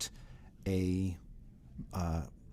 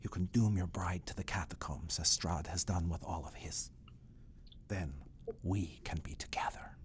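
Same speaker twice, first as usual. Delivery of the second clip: conspiratorial whisper; 2 semitones lower, 7 dB quieter than usual. Acted. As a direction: whisper it like a secret plan. Escher whispering his scheme.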